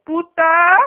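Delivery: loud Spanish insult, drawn out; mock angry yell. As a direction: yell it like an offended mother. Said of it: "maybe she was like".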